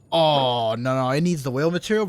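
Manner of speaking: ordinary delivery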